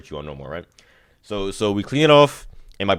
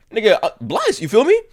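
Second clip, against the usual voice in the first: giddy high-pitched bless; excited squeal; 12 semitones higher, 3 dB louder than usual. Real, not acted. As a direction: voice jumps way up, thrilled. Excited about easy healing.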